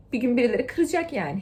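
ordinary talk, nothing marked

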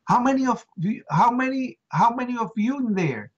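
high, urgent call into the shaft; retold yelling. Rescuer calling down to trapped men, high urgent yell. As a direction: yell down to find out who's there. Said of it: "I started screaming".

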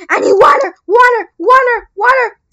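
high, loud frantic repetition; urgent panic. Real, not acted. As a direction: cry out urgently for help before it's too late. About to die, needs water fast.

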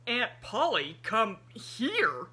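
loud, startled man in sudden dread; a man horrified at the idea; alarmed. A narrator's reading. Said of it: "terror leaped into the man's eyes".

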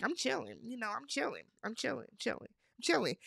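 imitating someone playing it cool, softly; mock nonchalance. Host mimicking him denying he is mad, soft mock nonchalance. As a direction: drop the volume and toss it off repeatedly, mimicking him. Retells his unconvincing claim to be chill.

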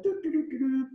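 high little doo-doo noises; happy humming. Acted. Little engine going along alone, happy tootling. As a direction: toodle along happily in a high voice. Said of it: "So she looks happy".

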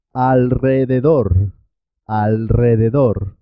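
trilled R after the l in "alrededor"; double R after L. Shown as a demonstration. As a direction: make "alrededor" vibrate as al-rrededor. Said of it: "pero suena doble".

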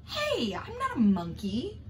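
ordinary delivery, nothing marked

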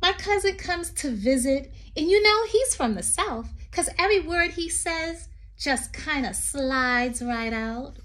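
sing-song read-aloud poem voice. Acted. Teacher reading a poem to children, lilting.

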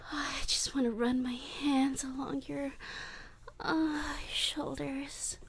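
hushed, trembling whisper; longing whisper. Acted. Captor whispers pleading longing over captive, hushed. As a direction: plead to touch just a little.

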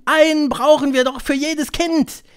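high, loud protest; high-pitched outcry; crowd crying out. A narrator's reading. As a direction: shout the objection like an alarmed audience. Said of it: "schrien die Zuhörerinnen".

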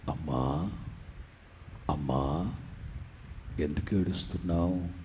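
low gentle voice of Jesus; Jesus asking gently. Acted. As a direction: ask her softly, like a comforting father. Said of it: "యేసయ్య అడుగుతారు".

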